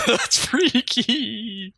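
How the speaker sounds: slow, drawn-out laughing freaky. Real, not acted. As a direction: laugh it out slowly. Reacting to the invisible friend.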